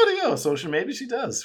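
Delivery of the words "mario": very high sweet princess call; Princess Peach voice. Retold or imitated. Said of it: "All we ever see is her goes".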